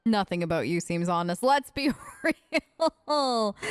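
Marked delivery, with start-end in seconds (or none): 1.4-3.6 breaking into a laugh, drawn out; scoff, then let it dissolve into laughter; laughing disbelief. Real, not acted. Player answering the self-declared honest merchant, laughing in disbelief.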